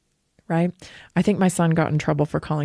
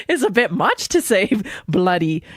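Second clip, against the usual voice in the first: amused, laugh breaking into the words. Real, not acted. Teacher recalls Americans' reaction to her word bloody, laughing. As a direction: say it through a laugh.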